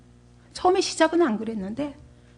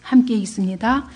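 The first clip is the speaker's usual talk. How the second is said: normal talking voice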